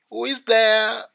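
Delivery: high, loud storybook granny; grandmother calling out. A narrator's reading. Grandmother in bed calls to whoever knocks, high loud call.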